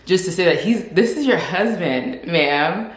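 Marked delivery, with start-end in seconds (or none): none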